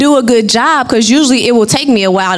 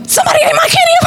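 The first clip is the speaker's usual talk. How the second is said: very high, whiny hammed-up wail; mock wailing cry; much faster than usual. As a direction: ham up a childish wail. Retold outburst over stolen candy.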